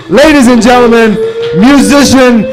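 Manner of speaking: rising, loud showman build-up; announcer voice. Acted. Announcing the next comedian.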